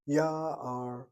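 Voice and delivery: ya'ar drawn out as YAA-ar; long first syllable in ya'ar, light ending; stress on first syllable. Shown as a demonstration. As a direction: stretch and accent the YA of ya'ar. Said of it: "demands the accent".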